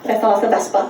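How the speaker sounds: normal talking voice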